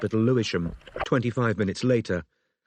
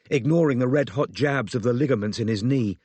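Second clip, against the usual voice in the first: ordinary delivery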